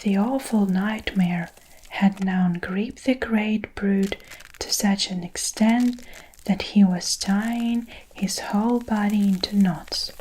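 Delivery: ordinary delivery, nothing marked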